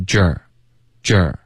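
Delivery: "撅儿" starting with pouted lips, relaxing into the r; "撅儿" as a rounded j gliding into er; rounded-lip pronunciation. Shown as a demonstration. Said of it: "橘，撅嘴唇".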